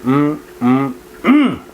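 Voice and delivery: humming through a mouthful; chewing while answering; mouth full. Real, not acted. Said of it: "I can't talk with my mouth full".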